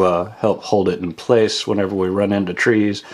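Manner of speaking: ordinary talk, nothing marked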